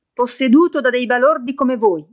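shouting back. A narrator's reading. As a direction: hurl it back at them. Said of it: "gridò Joseph di rimando".

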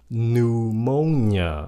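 PNEUMONIA very slowly, "noo-MO-nia"; silent P. Shown as a demonstration. Teacher models the word, slow PNEUMONIA with silent P. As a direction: stretch PNEUMONIA out, starting on N. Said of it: "âm P câm luôn".

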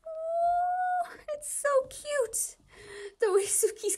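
high-pitched squeal over something cute; squealing coo. Real, not acted. Fan squealing at an adorable anime clip, high squeal. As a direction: squeal it like you just saw a puppy.

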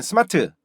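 스마트 in three even syllables; Konglish pronunciation. Shown as a demonstration. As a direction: say 스마트 as seu-ma-teu. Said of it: "이 콩글리시 발음도요". (콩글리시 스마트)

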